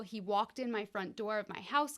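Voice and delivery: usual speaking voice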